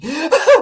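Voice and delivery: a sudden high, loud laugh; real laugh bursting out; burst of laughter. Real, not acted. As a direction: crack up at the memory.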